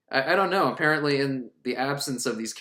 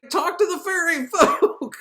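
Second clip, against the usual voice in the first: very high, slow, loud put-on voice; mock cartoon cry; 13 semitones higher, 6 dB louder than usual. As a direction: stretch it into a silly squawk. Mock cry imitating a character.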